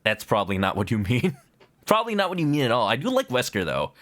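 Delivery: ordinary talk, nothing marked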